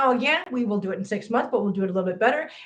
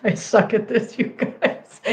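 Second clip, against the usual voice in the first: laughing; slower than usual. Real, not acted. Laughing at own failed accents.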